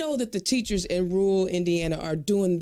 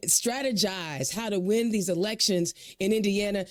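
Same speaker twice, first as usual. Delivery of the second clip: normal talking voice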